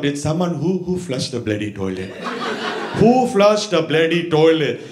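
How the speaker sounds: put-on cross old Indian man's accusation; Indian-accented grandpa hunting the culprit; mad Indian grandpa. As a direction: interrogate the kids in an Indian accent. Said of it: "He'll get mad".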